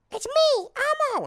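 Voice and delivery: very high squeaky puppet voice; Elmo voice. Pretend. For his date, a sudden high Elmo voice. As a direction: pitch way up like the red monster. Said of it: "my Elmo voice".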